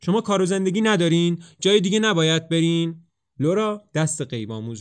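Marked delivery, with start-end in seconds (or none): 0.1-3.1 loud, higher indignant snap; annoyed outburst at the laughing pair; angry. A narrator's reading. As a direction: turn on them furiously. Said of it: "خشمگین به طرفشون برمیگرده".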